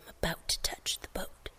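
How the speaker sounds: sneaky whisper; whispering kid. Retold or imitated. Child whispering to parent while daring, sneaky whisper. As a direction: breathe it like a child testing limits. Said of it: "and they're like".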